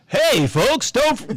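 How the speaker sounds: high, bright announcer voice, hammed up; radio voice. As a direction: boom it out like a DJ. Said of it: "Read it in your best radio voice".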